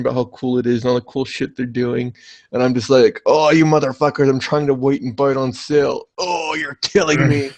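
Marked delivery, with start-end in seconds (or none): 3.2-7.5 comically anguished groaning complaint; exasperated. Acted. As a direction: wail it like the wait is agony. Acting out exasperation at a game's price.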